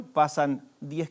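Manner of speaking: ordinary talk, nothing marked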